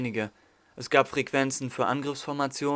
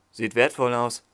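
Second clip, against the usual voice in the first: electronic vocoder voice through a helmet; distorted helmet voice; 4 dB louder than usual. A narrator's reading. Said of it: "elektronisch verzerrte Stimme".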